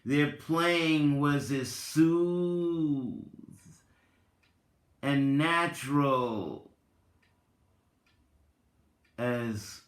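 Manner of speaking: measured storyteller reading, lingering on key words; story narration. Acted. Reads Tao parable aloud.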